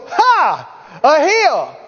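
bright, amused scoff at the tiny hill; cheerful scoff. Retold or imitated. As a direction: laugh off the hill as nothing. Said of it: "he goes".

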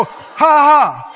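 defiant laugh. Shown as a demonstration. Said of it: "and go".

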